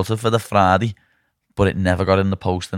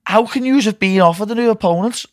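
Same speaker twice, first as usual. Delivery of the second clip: retold disbelief; 10 semitones higher than usual. Retold outrage at manager.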